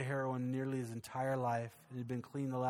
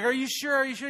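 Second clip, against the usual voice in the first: excited; 12 semitones higher, 11 dB louder than usual. Retold or imitated. Pastor doubtful, excited on the hook.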